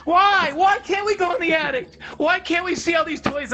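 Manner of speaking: put-on very high, loud, pleading kid wail; mock child sobbing and begging to go up to the attic; imitating a sobbing child. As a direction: beg like a small boy bawling for the attic. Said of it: "I was sobbing, I was like".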